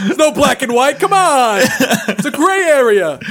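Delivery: high, loud laughing parent voice; lecturing dad. Retold or imitated. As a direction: preach like a know-it-all father, laughing. Joins in voicing the dad.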